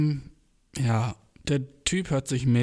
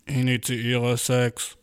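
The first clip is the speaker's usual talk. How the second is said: very slow, loud robot command; slow booming robot voice; male robot voice; 4 dB louder, much slower than usual. Retold or imitated. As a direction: intone it like a machine. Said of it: "Roboterstimme, so eine richtig männliche".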